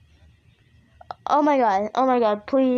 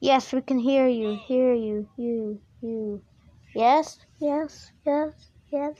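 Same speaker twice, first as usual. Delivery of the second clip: mock echo; slower than usual. Player answering a distant call for fun, mock echo. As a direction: repeat the last words, fading away.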